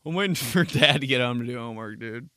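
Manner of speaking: ordinary delivery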